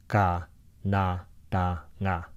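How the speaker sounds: slow "ka na ta nga", syllables spaced; Tagalog phrase. Shown as a demonstration. Teacher reading the Tagalog line slowly. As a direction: sound out the Filipino words slowly.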